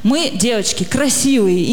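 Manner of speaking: ordinary talk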